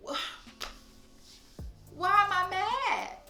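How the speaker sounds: high, slow, baffled cry of disbelief; bewildered outburst. Retold or imitated. To herself mid-episode, startled and baffled, high and slow. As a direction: throw it up high, lost and confused. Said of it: "you be like".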